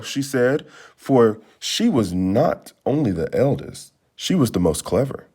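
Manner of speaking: ordinary delivery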